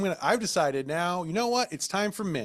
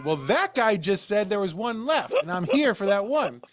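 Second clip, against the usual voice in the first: high, louder, slow indignant complaint; indignant protest; 6 semitones higher, 3 dB louder than usual. Retold or imitated. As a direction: insist with annoyance. Arguing with the salesman.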